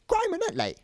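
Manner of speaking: put-on very high, loud old-man cry; shouted exclamation. A narrator's reading. Old man fooled by a phoenix sighting, shouting. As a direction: shriek it in amazement. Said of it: "shouted Mister Ollivander".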